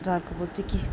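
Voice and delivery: ordinary delivery, nothing marked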